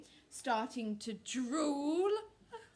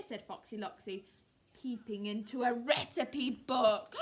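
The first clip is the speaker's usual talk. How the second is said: ordinary delivery, nothing marked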